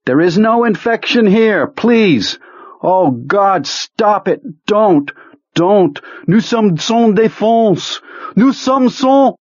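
high, anguished cries for mercy; desperate pleading. A narrator's reading. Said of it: "then began to weep".

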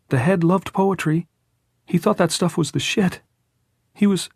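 put-on high, strained-cheerful boy. A narrator's reading. Jordan remembering the dead head, voice about to break. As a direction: keep it brightly high until it gives way.

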